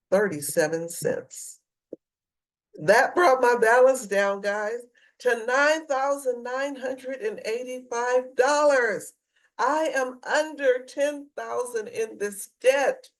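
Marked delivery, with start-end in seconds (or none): 2.8-13.1 thrilled to announce the new balance; excited. Real, not acted. Vlogger tells viewers her debt fell under ten thousand, excited and higher. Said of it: "I am so emotional right now".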